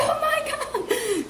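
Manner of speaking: put-on high, slow gushing child's gasp; excited kid. Children seeing their teacher, high gush. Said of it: "they're like".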